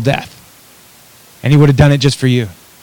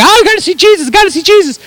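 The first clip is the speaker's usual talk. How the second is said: very high, put-on fan squeal; excited mob. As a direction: imitate an excited crowd in a high-pitched mock voice. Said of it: "they're just like a mob".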